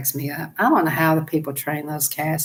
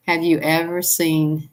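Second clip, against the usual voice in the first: ordinary talk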